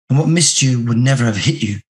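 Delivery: ordinary delivery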